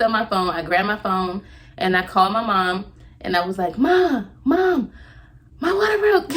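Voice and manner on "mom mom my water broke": high, loud panicked cry to mom; frantic, urgent plea for help; panicked call. Retold or imitated.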